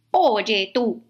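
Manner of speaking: grumpy little ladybug challenging; grouchy ladybug. Acted. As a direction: pick a fight like a cranky bug. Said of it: "le dijo la mariquita malhumorada".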